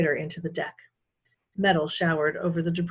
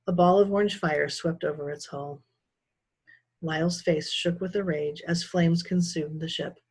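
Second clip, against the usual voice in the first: plain reading voice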